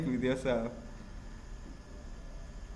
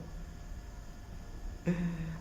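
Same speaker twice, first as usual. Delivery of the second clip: quiet, high giggle; soft laughter; 4 semitones higher, 10 dB quieter than usual. Real, not acted. Host unsure of own voice, soft high giggle. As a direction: laugh softly to yourself.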